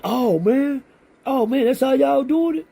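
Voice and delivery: high, startled voice of a man caught off guard; surprised victim. Retold or imitated. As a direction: squeak it out as if jumped. Victim surprised, acted quote.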